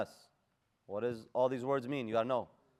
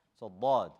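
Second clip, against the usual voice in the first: ordinary delivery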